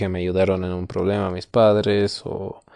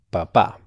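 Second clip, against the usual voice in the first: papá with a stressed final á; stress on last syllable. Shown as a demonstration. Teacher explaining father of a family, final stress on papá. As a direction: lean on the second syllable of papá. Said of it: "si está en la última sílaba".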